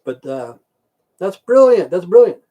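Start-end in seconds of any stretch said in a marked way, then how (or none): 1.2-2.4 loud praise. Real, not acted. Mock praise of his own story.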